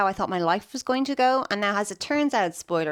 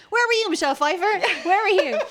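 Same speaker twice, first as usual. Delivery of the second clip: higher, louder plea; mock exasperated cry; 12 semitones higher, 6 dB louder than usual. Guest mock-pleads to Michelle Pfeiffer, exasperated cry. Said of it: "I was like".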